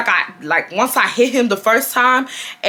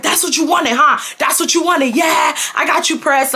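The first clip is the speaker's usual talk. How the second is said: retold going off; 5 semitones higher than usual. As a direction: re-enact yourself shouting back at him. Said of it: "going off too".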